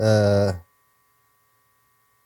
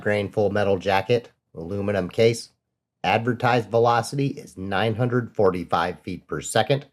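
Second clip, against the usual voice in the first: lower, even voiceover reading out the test results; 5 semitones lower than usual. Acted. Host recaps ammo velocity test, steady voiceover read. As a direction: read the numbers like a calm recorded narration.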